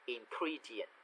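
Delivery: "ingredient" with e said as ee; e read as i. Shown as a demonstration. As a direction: model "ingredient" softly, e sounding as i. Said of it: "chữ âm e thì đọc là âm i". (đọc khẽ "ingredient", chữ e thành âm i)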